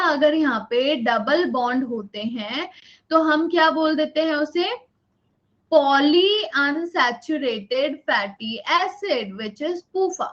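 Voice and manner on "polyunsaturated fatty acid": very slow, every word spaced for note-taking; teacher dictating notes word by word; slow dictation. Shown as a demonstration. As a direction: dictate it slowly so they can write. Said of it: "dictating term slowly".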